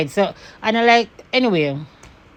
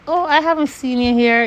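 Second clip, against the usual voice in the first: higher, sugary mock politeness with a fake smile; fake sweet voice; 6 semitones higher than usual. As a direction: put on the woman's falsely polite smiling voice. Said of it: "in that fucking voice they do".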